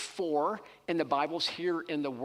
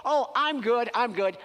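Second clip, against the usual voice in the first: breezy self-assurance; 6 semitones higher, 7 dB louder than usual. Retold or imitated. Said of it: "we also can't just say".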